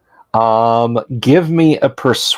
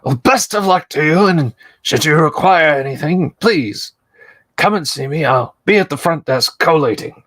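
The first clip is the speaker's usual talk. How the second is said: polite librarian voice; 5 semitones higher than usual. Acted. NPC librarian voice.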